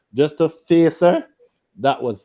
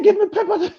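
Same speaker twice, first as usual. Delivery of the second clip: sudden high squeal of outrage; shocked outburst. Retold or imitated. New husband squealing at his wife over hot pepper. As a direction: yelp it up high in disbelief.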